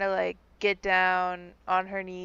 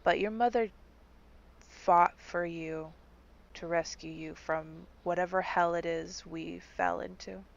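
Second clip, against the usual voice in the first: tender consoling; 7 dB quieter than usual. Acted. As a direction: console her gently, like a grieving friend. Bard consoles the grieving girl.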